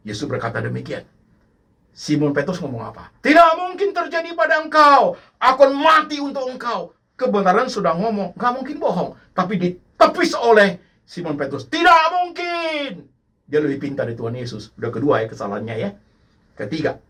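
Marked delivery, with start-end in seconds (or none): 3.2-7.0 indignant shout. Acted. Peter shouting his refusal at Jesus, high and loud. 11.7-13.0 slow shouted denial. Acted. Peter dismissing Jesus' warning, slow high shout.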